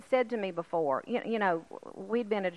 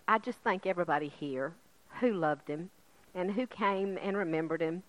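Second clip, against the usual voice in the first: normal talking voice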